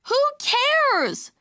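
high, loud, drawn-out outburst; little sister's high-pitched wail; exasperated cry. A narrator's reading. Upset sister throws up her hands.

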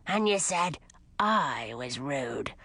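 low slow sulky grumble; grumpy skull voice. Acted.

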